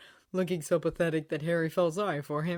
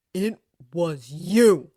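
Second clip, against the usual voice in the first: slow, louder realization; slowly; 11 dB louder, much slower than usual. A narrator's reading. Harry realizing Dobby blocked him, slow and pointed. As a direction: lay each word down slowly as it dawns. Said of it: "he said slowly".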